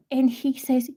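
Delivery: ordinary talk, nothing marked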